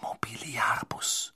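slow breathy spell whisper. A narrator's reading. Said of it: "Über ihm flüsterte Hermine".

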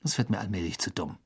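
low, quick, grumpy undertone; disgruntled. A narrator's reading. Ron is fed up with being refused, disgruntled. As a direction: grumble it softly and fast. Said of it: "der höchst missgelaunt aussah".

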